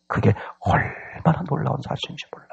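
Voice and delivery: soft, whispered amazement; near-whisper with a stretched emphasis; hushed awe. Real, not acted. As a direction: drop to a breath to marvel at it.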